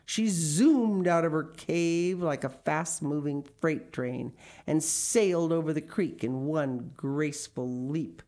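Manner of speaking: plain reading voice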